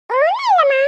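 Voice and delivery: put-on high small child's voice; little girl. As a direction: answer like a little daughter calling out to mum. Little daughter answers from inside. (அம்மாவிடம் பதில் சொல்லும் சிறுமியின் உயர்ந்த குரல்)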